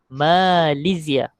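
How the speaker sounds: Malaysia drawn out slowly as "malisia"; teacher repeating Malaysia slowly in Arabic for pupils; slow Arabic pronunciation. Shown as a demonstration.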